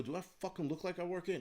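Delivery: indignant comeback, as retold; retold snapping. Shopper mistaken for staff, retold irritated snap. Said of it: "I snapped at her".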